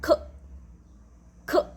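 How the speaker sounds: ख as a sound between k and kh; phonic k sound. Shown as a demonstration. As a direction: give ख a breathy k, between क and ख. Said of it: "इसकी sound होती है".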